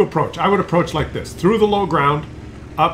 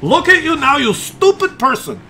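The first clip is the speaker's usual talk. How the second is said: high-pitched teasing jab, in jest; lovable mock insult; 11 semitones higher, 5 dB louder than usual. Real, not acted. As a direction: tease them loudly and fondly, voice pitched way up. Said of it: "I say that in a lovable way, in jest".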